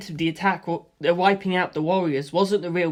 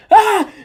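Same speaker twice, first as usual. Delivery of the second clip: sudden high loud cry; startled scream; 11 dB louder than usual. A narrator's reading. Friend cries out when a bomb hits, scream. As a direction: yelp at an explosion. Explosion on phone line.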